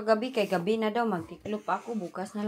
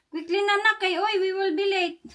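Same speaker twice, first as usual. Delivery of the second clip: high, louder hurry-up call; 7 semitones higher, 4 dB louder than usual. Real, not acted. Mom urging her child to hurry, raised call.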